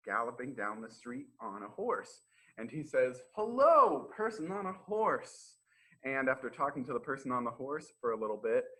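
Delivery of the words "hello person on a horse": cheerful greeting. Acted. Hans greets rider cheerfully.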